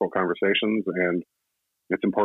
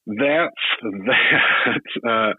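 laughing; 4 semitones higher, 4 dB louder, much slower than usual. Real, not acted. Laughing at own remark.

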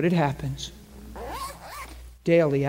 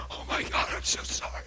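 tearful whisper; 8 dB quieter than usual. Retold or imitated. Woman crying, apologizing.

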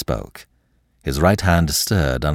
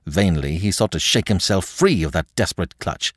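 ordinary delivery

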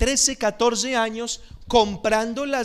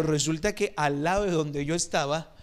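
ordinary talk, nothing marked

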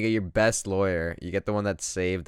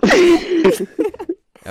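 high, slow laughing words; 11 dB louder than usual. Real, not acted. Laughing mid-sentence.

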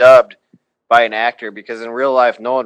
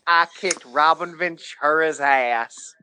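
mock Nolan Ryan; 5 semitones higher, 5 dB quieter, slower than usual. Imitating Nolan Ryan's voice.

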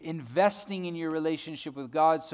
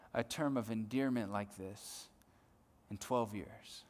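hushed tenderness; 6 semitones lower, 11 dB quieter than usual. Real, not acted. Tender aside about the woman.

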